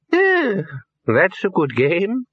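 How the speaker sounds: very high, slow querulous voice; old Poons. A narrator's reading. Old wizard approving of the game, high-pitched. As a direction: pipe up in a high, muddled voice.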